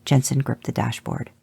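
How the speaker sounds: usual speaking voice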